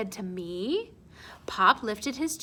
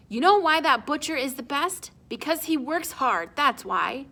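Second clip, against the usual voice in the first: a touch of a stern father; 6 semitones higher, 5 dB louder than usual. Acted. Pop lecturing at dinner.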